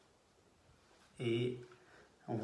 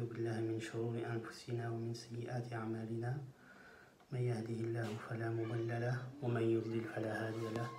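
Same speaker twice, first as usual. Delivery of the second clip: fast, even liturgical recitation of the opening formula; rapid Arabic recitation; much faster than usual. Acted. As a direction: rattle off the Arabic invocation in one breath. Opens lesson with Arabic formula.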